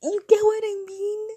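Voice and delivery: high, loud, emphatic question; excited emphatic. Real, not acted.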